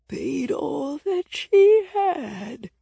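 very slow, high, scratchy ancient croon; old, scratchy voice. A narrator's reading. Stooped cave fish person goes on, old and scratchy. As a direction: stretch each word in an aged, cracked voice. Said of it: "their voice so old and scratchy".

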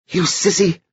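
put-on high-pitched girlish scorn; hissed scolding. A narrator's reading. Edie scolds the brother inside her, high hissed scorn. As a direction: spit it out high at a timid brother. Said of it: "she hissed".

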